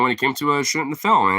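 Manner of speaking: ordinary talk, nothing marked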